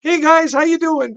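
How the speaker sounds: forced breezy greeting pitched high to hide the pain; fake casual cheer. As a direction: act totally fine while hurting, voice pushed up. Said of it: "I was talking like this".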